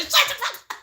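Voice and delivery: high, loud snapping quote; mock yell. Quoting Alexis yelling at the baby, mock shout. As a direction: bark it like an annoyed roommate. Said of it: "Alexis came in and said".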